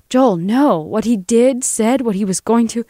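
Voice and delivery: high, agitated pleading; distressed plea. Acted. Lena justifies the shooting to Joel, pleading in distress.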